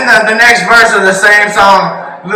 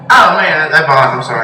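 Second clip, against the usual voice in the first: ordinary talk, nothing marked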